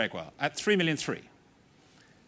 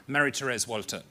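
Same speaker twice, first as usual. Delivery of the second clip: usual speaking voice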